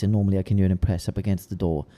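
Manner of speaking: ordinary talk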